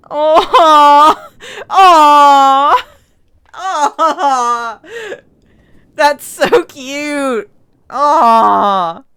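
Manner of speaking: real laughing cry, loud and gushing; real squealing laugh at a cute moment; overwhelmed laughing. Real, not acted. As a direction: crack up with delight at something adorable. Overcome watching the cute scene.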